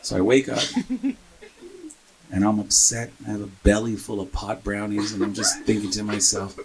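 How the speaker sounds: usual speaking voice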